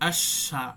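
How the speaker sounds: আশ with the শ hissed on and held long; আশা with a long flowing শ; held shin. Shown as a demonstration. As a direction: draw out the শ in আশ without stopping. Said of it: "এই যে ধরে রাখাটা".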